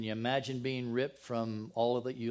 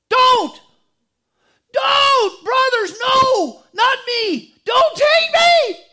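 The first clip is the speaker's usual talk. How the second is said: very loud, high, anguished shouts, slow at the end; desperate screaming; 21 dB louder, much slower than usual. Retold or imitated. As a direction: scream for your life as they drag you off. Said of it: "Can you hear him yelling, screaming?".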